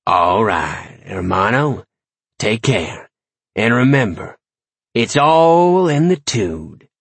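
lightly voiced swaggering warlock sign-off; cocky warlock. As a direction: build to a drawn-out, punched last line.

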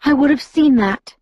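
high, firm protest; Alice, insistent. A narrator's reading. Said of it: "Alice insisted".

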